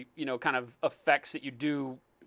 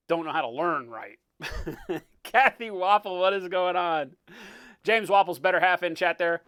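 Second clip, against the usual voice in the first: ordinary delivery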